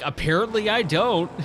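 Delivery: high-pitched comic concession; self-mocking retold reply; mock admission. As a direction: own up playfully, pitch jumping up. Acted sheepish admission before laughing.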